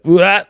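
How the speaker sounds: short, high, loud yelp; startled yelp. Retold or imitated. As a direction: yelp as if jolted by fright. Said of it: "I was like".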